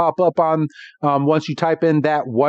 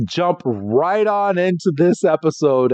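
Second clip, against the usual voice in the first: ordinary talk, nothing marked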